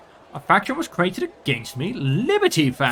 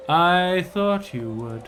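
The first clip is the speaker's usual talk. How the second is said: drawn out; much slower than usual. Shown as a demonstration. Ruler answering his advisor, slow and drawn out. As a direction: say "I thought you would" very slowly, stretching each word.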